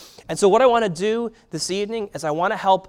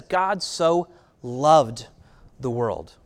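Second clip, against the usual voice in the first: slow emphatic quote; 5 semitones lower, much slower than usual. Acted. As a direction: draw out so loved, drop the world. Contrasts with wrong images.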